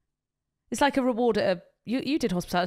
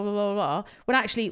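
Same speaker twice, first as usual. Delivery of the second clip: ordinary talk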